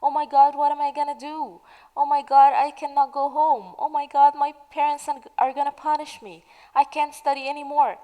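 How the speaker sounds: exaggerated pretend distress over a failed exam; mock crying student. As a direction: play the upset student who failed.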